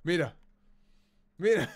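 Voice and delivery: ordinary delivery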